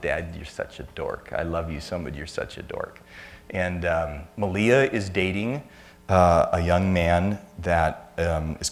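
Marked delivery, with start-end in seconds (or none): 0.0-3.0 affectionate tease. Retold or imitated. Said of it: "smiles and says".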